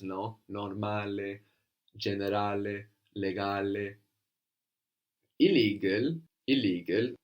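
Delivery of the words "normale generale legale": measured "Normale, generale, legale" with open final E; Italian model pronunciation, long stressed A, clear final E. Shown as a demonstration. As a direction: sound the E ending each of "Normale, generale, legale". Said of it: "same sound that we're adding on to the end".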